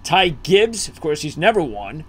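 usual speaking voice

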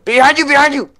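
high, loud alarmed call; urgent warning shout. Real, not acted. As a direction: yell the warning sharply. Warning partner of enemy behind.

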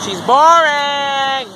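slow, high, loud singsong she's boring; mock whine at a friend; teasing yell. Real, not acted. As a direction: drag out the taunt loudly.

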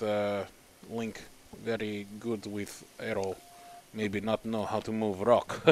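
lightly voiced Russian accent, slowed; a hint of a Russian comrade. Streamer reading a super chat, light Russian accent. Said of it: "comrade, Soviet".

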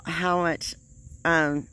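lip held down by finger; speaking around a finger. Real, not acted. Seen 1: Finger pulls lower lip down onto teeth until 0.8, then removed.

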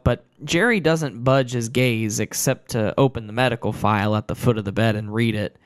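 usual speaking voice

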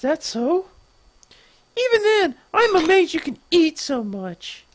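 very high-pitched scolding; indignant sister. Acted. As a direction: accuse them in a high put-on voice. Sister scolds brother, acted.

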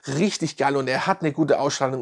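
ordinary delivery

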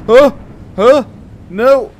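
sharp, startled yelps. Real, not acted. Player startled on one of those rides, alarmed.

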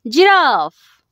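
normal talking voice

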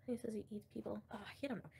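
hushed unvoiced aside, barely breathed; whisper. Real, not acted. Streamer whispering an aside to her chat. Aside about a boasting man.

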